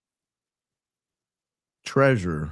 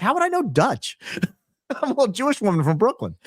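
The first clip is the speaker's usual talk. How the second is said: much higher, giggling self-mocking voice; professor laughing at herself; 12 semitones higher than usual. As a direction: play the little Brooklyn professor laughing. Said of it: "she's like".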